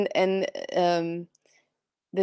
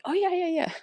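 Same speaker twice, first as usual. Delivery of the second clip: imitating eager agreement; 11 semitones higher, faster than usual. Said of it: "you were like".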